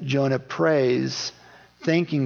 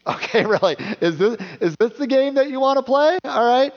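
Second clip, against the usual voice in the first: laughing, much higher incredulous God; a light laugh, then a high teasing challenge; amused God voice; 6 semitones higher than usual. Retold or imitated. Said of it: "God's like".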